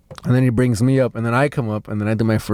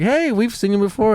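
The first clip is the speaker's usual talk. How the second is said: high-pitched imitation of an excited fan; eager fan greeting; 9 semitones higher than usual. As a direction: pitch it up like spotting someone familiar. Retelling fans greeting him.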